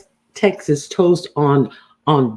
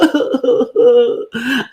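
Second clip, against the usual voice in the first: fake sob; 10 semitones higher than usual. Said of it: "teary cry".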